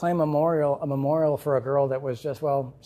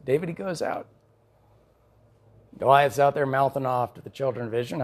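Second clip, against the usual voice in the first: normal talking voice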